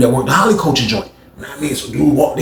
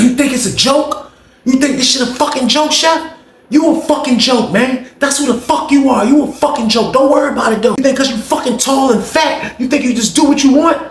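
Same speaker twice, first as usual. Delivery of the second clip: mock furious rant; 11 semitones higher than usual. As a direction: rant at him, pitched high.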